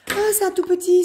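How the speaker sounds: surprised discovery. Real, not acted. Discovers the small tray.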